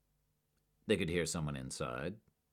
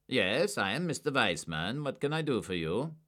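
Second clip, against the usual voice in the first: a hint of a guarded, higher voice; reserved suspect; 7 semitones higher, 4 dB louder than usual. A narrator's reading. Suspect greeting two agents, lightly voiced and guarded. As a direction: give nothing away. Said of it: "Weissman spoke slowly but reservedly".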